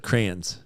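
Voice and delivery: crayons run into one syllable, like "crans"; Southern pronunciation. Retold or imitated. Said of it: "people down south say, like".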